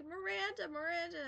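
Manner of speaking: high, sing-song calling of the name; little girl calling. Retold or imitated. Little girl calling her babysitter, high sing-song voice. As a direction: call her name like an eager small girl. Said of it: "she's like".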